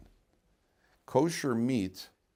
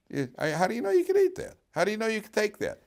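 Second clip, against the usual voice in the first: challenging question; 13 semitones higher than usual. Acted. Challenging a listener.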